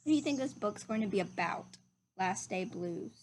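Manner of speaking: usual speaking voice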